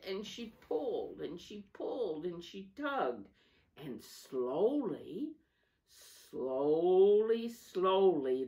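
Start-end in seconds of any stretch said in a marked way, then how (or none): 3.8-8.4 slowly drawn out. A narrator's reading. Train straining to start.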